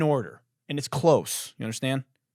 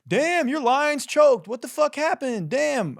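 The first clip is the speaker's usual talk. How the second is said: high, loud, slow taunting "damn, your Lions choked"; shrill mock-sympathy of a rival fan; mocking texter; 7 dB louder, slower than usual. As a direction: gloat like a smug friend rubbing it in. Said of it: "texting me, like".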